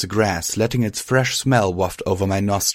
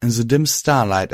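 usual speaking voice

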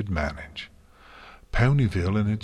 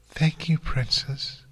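high, gentle character voice; gentle murmur; 8 semitones higher, slower than usual. A narrator's reading. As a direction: murmur it sweetly in a raised pitch. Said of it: "murmured in her gentle, even voice".

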